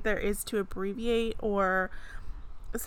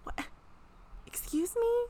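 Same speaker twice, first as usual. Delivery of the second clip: mock offended; 14 semitones higher, 5 dB quieter than usual.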